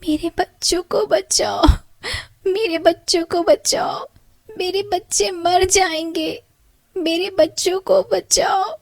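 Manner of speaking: high weeping bird begging; crying bird. Acted. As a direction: cry for help as a desperate mother bird. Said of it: "चिड़िया के रोने की आवाज़".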